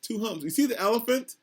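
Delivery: plain reading voice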